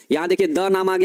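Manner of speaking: ordinary talk, nothing marked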